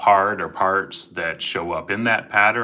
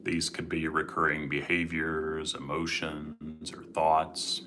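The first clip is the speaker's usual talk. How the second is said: guided meditation; 7 dB quieter than usual. Acted. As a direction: gently guide listeners into calm.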